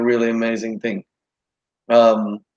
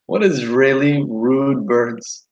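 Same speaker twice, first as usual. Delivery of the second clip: slow, drawn-out mock outrage at the birds; mock indignant; much slower than usual. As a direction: drag it out as if offended by the birds. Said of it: "I'm like".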